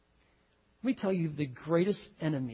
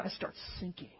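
sinking whisper; 13 dB quieter than usual. Acted. Describing sinking.

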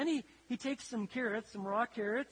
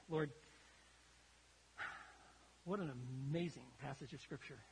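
softer, lower prayerful voice; 4 semitones lower, 4 dB quieter than usual. Acted. Pastor leading congregation in prayer, softer and lower. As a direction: turn to God quietly.

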